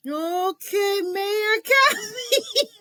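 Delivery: very high, slow, laughing. Retold or imitated. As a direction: giggle out the name. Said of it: "I said".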